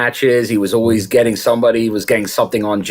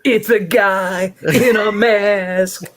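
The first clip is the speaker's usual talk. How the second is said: high, slow mock announcement; mock announcer; 10 semitones higher, slower than usual. Joking about the masked Chikara guy, high and slow. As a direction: stretch it out high and silly. Imitating a masked wrestler joke.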